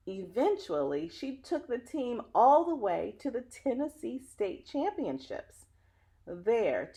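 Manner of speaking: put-on lively read-aloud voice for children; story narration. Reading a picture book to children, lively storyteller. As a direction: tell the story warmly to young listeners.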